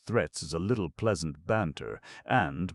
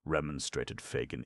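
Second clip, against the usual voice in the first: plain reading voice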